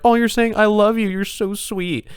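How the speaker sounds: put-on high, sugary baby talk; doting owner. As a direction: coo at the dog. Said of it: "they're like".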